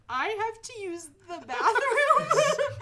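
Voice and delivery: high, loud, slow awkward plea; nervous stalling excuse. Retold or imitated. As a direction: drag it out like a caught kid. Stalling in class as cops arrive.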